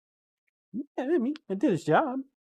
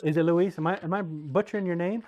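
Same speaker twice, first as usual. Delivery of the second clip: usual speaking voice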